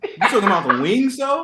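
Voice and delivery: real laughter in the words; laughing question. Real, not acted. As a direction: ask while cracking up. Cracking up over the snack mix-up.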